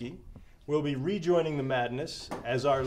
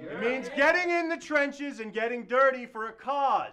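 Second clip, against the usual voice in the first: rallying shout; 7 semitones higher, 4 dB louder than usual. Acted. Rallying speech to brothers.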